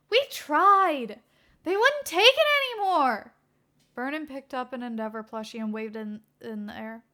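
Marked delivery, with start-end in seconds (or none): 0.1-3.3 high and loud; a fed-up helper sighing out the failure; whiny complaint. Acted. Burnin telling Naomasa, high and whiny.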